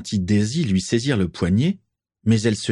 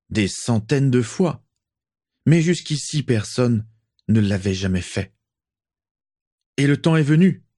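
rousing speech; 5 semitones higher than usual. Acted. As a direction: stir the crowd toward a new world. Lucius rallies the crowd.

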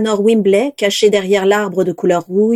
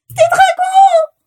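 very high, loud panicked shout; shrill cry; 5 dB louder, slower than usual. A narrator's reading. A guest spots the dragons hitting the dome, shrill terrified shout. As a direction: scream the alarm to the crowd. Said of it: "cria la voix aiguë".

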